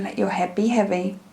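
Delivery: ordinary talk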